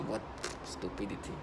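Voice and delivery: muttered scorn. Real, not acted. Dismissing the voices, a low soft scornful mutter. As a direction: drop it under your breath.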